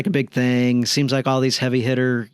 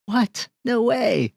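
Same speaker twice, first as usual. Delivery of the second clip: high-pitched happy disbelief; astonished, voice leaping up; amazed disbelief; 12 semitones higher, much slower than usual. Retold or imitated. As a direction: blurt it high, as if stunned by good news. His delighted disbelief at wife agreeing.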